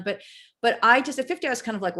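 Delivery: ordinary delivery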